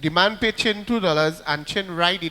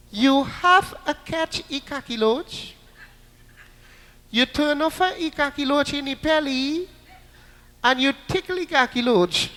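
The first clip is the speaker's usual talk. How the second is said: put-on Chinese-Trinidadian accent; 5 semitones higher than usual. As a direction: explain the silly instructions in a mock accent. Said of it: "they talk in a very funny Trinidad accent".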